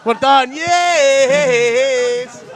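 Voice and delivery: loud, happy cheer; excited cheer. Real, not acted. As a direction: celebrate like you just won. Celebrating the accent attempt.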